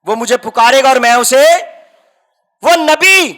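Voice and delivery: impassioned preacher pushing the story forward; full-force preaching. Acted. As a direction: preach it out to the congregation.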